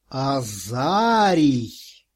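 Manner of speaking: name drawn out slowly, mocking; ironic drawl. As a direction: stretch the name out to mock him. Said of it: "иронично протянул". (иронично протянуть имя)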